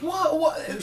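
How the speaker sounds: high-pitched reenacted astonishment; amazed disbelief. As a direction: act out being blown away, pitch shooting up. Reenacts amazed reaction to wrestling moves.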